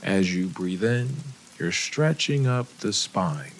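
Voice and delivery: unhurried guide with long pauses between phrases; guided meditation. Acted. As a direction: lead them gently through each breath, softening as the body relaxes. Leads a breathing and body scan.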